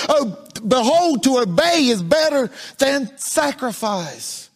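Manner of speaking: building sing-song cadence, scripture slowed at the end; preaching. Acted. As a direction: rise toward the quoted verse. Preaching at full force.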